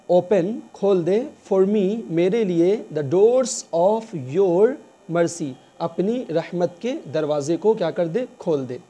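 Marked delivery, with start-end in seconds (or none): 2.9-5.5 very slow, each word drawn out; slow dictation. Shown as a demonstration. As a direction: spell it out slowly for learners. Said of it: "slow teaching read". (बहुत धीरे, रुक-रुक कर)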